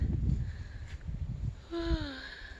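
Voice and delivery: long high sigh of relief; contented sigh. Real, not acted. Woman sighing in the mountain breeze. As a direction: let out a long sigh at the view.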